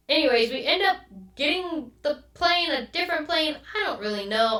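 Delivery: ordinary delivery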